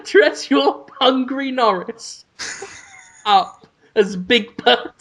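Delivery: giggling through the words; cracking up while talking; laughing. Real, not acted. Laughing fit mid-sentence.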